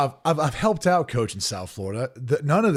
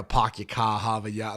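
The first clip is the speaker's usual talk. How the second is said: imitated Boston accent with dropped R's; 3 semitones lower than usual. Talking like the in-laws from Boston, imitated Boston accent. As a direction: mimic Boston in-laws, swallowing every R. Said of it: "they talk like that".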